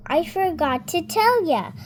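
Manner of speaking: ordinary delivery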